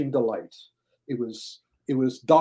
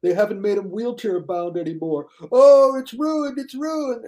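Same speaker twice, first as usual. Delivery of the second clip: high, slow wail of outrage; high loud mock lament, stretched out; mock whiny fan; 7 semitones higher, 3 dB louder than usual. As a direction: whine like a fan whose show died. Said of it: "And it's like".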